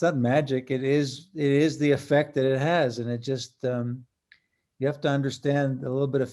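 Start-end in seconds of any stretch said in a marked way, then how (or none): none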